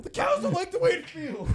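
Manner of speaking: high laughing shout; furious God, laughing through the shout; table-flipping rage. Retold or imitated. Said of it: "flips the table".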